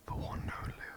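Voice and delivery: usual speaking voice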